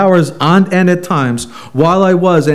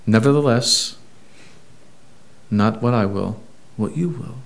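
quiet surrender; 5 semitones lower, 9 dB quieter than usual. Retold or imitated. Jesus yielding to Father's will.

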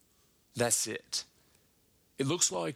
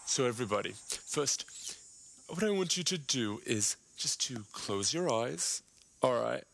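mock guided breathing. Comic leading the crowd through a mock breathing exercise, calm instructor voice.

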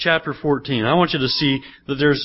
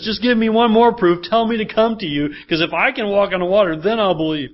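higher, eager, bargaining voice; lightly voiced Peter begging for a sign; pleading Peter; 6 semitones higher, 3 dB louder than usual. Pastor voices Peter asking for one more proof, eager pleading.

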